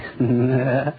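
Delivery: short gloating villain laugh; villain's laugh. Acted. Villain laughing over the sleeping Watson, gloating. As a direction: laugh in triumph over him.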